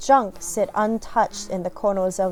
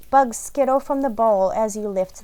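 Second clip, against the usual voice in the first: normal talking voice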